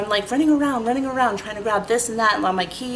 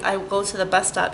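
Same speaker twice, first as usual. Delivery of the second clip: ordinary talk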